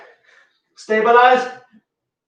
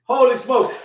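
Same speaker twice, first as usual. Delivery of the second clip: ordinary talk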